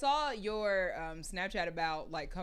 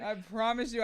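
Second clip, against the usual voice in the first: usual speaking voice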